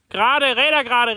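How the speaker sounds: loud, high, urgent German command; calling out. Real, not acted. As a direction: call out to the driver to straighten. Guiding the driver, calling out.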